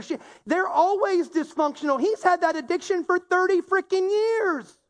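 exasperated imitated outburst about chronic lateness; imitating angry rant. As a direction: act out a fed-up rant, pitch up high. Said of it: "you're so angry and you're so mad and you're like".

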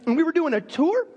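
normal talking voice

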